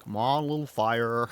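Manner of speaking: loud, slow North American drawl; imitating Astrid. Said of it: "Deaf more Southern American the second time".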